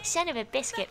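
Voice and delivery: high-pitched cutesy character voice; Butters impression. Gamer showing off a game buddy's banter, high impression. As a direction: mimic a sweet cartoon kid cursing mildly. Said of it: "That's a good impression".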